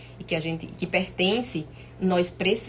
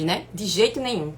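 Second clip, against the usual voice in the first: ordinary talk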